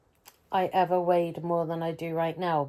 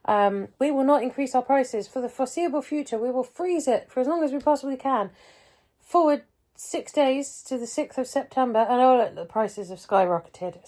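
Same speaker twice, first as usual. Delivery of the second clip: higher, mock-cheerful corporate spiel; sarcastic sing-song sales talk; mocking pharmacy voice; 6 semitones higher, 4 dB louder than usual. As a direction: parody the company's empty promises. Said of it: "Pharmacy T U went".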